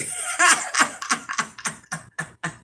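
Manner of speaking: a high, breathy laugh. Real, not acted. Woman remembering people heard her slip, high giggle.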